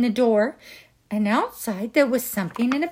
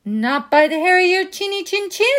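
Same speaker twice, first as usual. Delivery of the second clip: higher, quicker piggy voice; little pigs; 7 semitones higher, faster than usual. Acted. Pigs refusing the wolf, higher and fast. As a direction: chirp it back quickly. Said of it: "said the little pigs".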